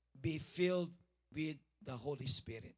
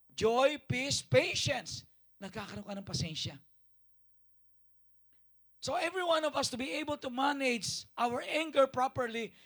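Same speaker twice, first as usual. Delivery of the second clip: high, loud sermon voice; fiery preaching; 8 semitones higher, 9 dB louder than usual. Acted. Pastor urging the congregation, fiery preaching. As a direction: preach with full force.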